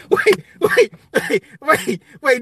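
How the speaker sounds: trying to talk through laughter; laughing wait. Real, not acted. Laughing as he tries to go on with the story.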